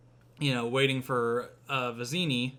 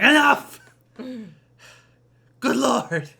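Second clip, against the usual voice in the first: much higher, much louder exasperated yell; fed-up shout; 10 semitones higher, 11 dB louder than usual. Acted. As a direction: Inigo snaps at Fezzik. Inigo fed up with water.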